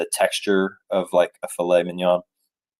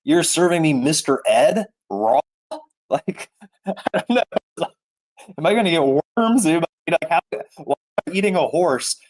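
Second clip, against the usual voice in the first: high, half-laughing disbelief; incredulous; 7 semitones higher than usual. Retold or imitated. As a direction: pitch up, stunned and amused. Reacting to raw horse sashimi.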